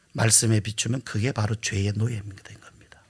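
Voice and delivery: hushed pulpit whisper; solemn whisper. Real, not acted. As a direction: whisper the hard truth. Solemn point whispered to congregation.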